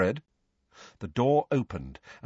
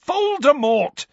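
much higher, loud angry cry of the name; furious shout; 7 dB louder, slower than usual. A narrator's reading. Harry yelling at Ron and Hermione, furious. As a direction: hurl the name at them in rage. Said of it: "said Harry furiously".

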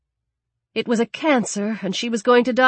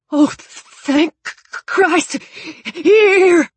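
out of breath; 10 semitones higher, 6 dB louder, slower than usual. Acted. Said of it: "winded, out of breath".

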